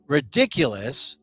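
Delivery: ordinary delivery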